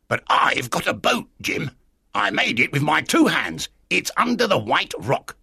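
high, odd castaway voice; strange castaway. Acted. Castaway telling the boy of his hidden boat, strange voice.

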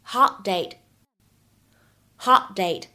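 "Hot date" with a silent held t; "Hot date" linked, t swallowed; loss of plosion. Shown as a demonstration. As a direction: cut "Hot" short without releasing t, then say "date". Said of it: "会有一个典型的失去爆破".

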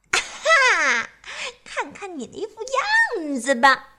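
high mocking laugh; gloating mock. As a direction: jeer with glee. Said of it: "幸灾乐祸地嘲笑".

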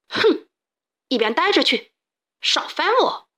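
irritated brush-off. A narrator's reading. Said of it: "懒得搭理".

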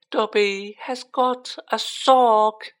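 very slow, high elf voice; disbelieving elf. A narrator's reading. Dobby holding up the sock, in disbelief. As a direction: draw it out high, hardly believing it. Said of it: "said Dobby in disbelief".

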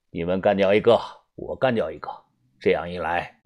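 low, cool boss voice; offhand, calm. Acted. Tian Sanjiu explaining his ruthless rule to the narrator, offhand. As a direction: say it casually in a low, even voice. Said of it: "他随口说".

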